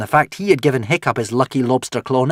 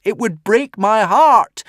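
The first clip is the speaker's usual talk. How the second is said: tearful cry; 13 semitones higher, 7 dB louder, slower than usual. Acted. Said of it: "tears streaming down his face".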